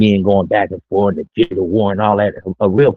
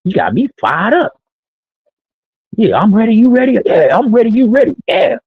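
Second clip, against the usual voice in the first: fired-up hype; 12 semitones higher than usual. Retold or imitated. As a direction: get hyped like before the big game. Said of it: "I'd be like".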